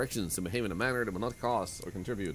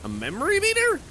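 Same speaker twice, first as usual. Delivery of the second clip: surprised question; 5 dB louder than usual. Real, not acted. Finds item, surprised.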